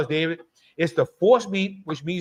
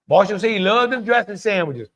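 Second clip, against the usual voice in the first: ordinary talk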